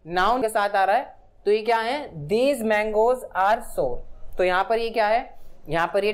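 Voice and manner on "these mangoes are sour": very slow, each word set apart; slow example read. Shown as a demonstration. Teacher reading an example sentence to the class, very slow.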